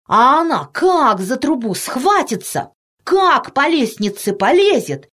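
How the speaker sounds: put-on high, drawn-out excited boy voice; chattering boy. A narrator's reading. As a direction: gush on like an amazed little boy. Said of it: "ни на минуту не замолкал рыжий мальчишка". (мальчишка тараторит восторженно, нараспев)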